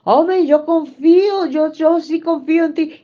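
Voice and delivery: high, loud mimicked claim of trust; mock believer's claim. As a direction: parrot the believer's loud insistence. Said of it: "Así dicen".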